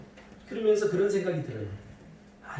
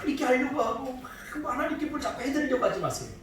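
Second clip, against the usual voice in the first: higher, louder pulpit fervour with bursts of laughter; rising fervent preaching; 8 semitones higher, 3 dB louder than usual. Acted. Pastor urging the congregation to receive grace, fervent preaching. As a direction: drive the point home to the congregation.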